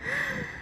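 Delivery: quick light laugh; a brief amused laugh; soft breathy chuckle; short laugh. A narrator's reading. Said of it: "She laughs".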